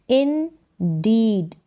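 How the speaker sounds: "Indeed" stretched slowly in two separate parts; syllable by syllable. Shown as a demonstration. Teacher sounding out a word for beginners, syllable by syllable. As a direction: sound out "Indeed" as in-deed, drawn out.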